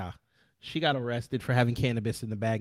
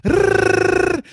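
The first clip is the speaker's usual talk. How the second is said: angry growl; 8 dB louder than usual. Retold or imitated. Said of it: "that is my impression of how they sound".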